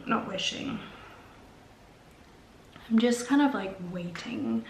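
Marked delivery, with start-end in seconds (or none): none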